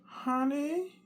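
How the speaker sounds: high questioning call; calling wife. Retold or imitated. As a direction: sing out the word Honey. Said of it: "I call my".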